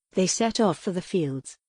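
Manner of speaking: plain reading voice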